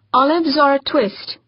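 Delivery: polite robot, hint of British; courteous machine question, faint British lilt; robot waiter voice. A narrator's reading. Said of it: "asked the robot".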